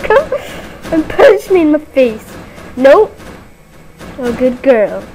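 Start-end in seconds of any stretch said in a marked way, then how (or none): 0.0-2.4 very high, laughing through the words; laughing panic. Real, not acted. Player fearing a killer is coming, laughing panic. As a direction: squeal it half-laughing.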